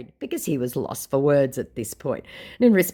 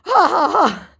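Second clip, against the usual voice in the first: unhinged laugh; 7 semitones higher, 8 dB louder, slower than usual. Retold or imitated. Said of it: "He was completely unhinged at this point".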